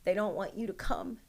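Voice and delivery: imitating crying son. Son on the phone to his mom about being kept out, upset and pleading. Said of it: "I'm listening to him cry and he's like".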